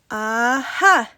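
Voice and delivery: loud discovery cry; triumphant aha. A narrator's reading. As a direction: found it at last. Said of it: "Lucas screamed".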